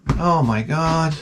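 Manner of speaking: usual speaking voice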